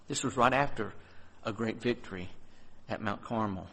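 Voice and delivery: softly, sinking into the thought; hushed. Real, not acted. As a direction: let it fade to a near hush. Fading reflection after quote.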